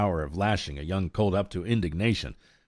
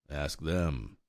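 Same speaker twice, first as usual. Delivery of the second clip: coldly; 3 semitones lower, 7 dB quieter than usual. A narrator's reading. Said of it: "said Egeria coldly".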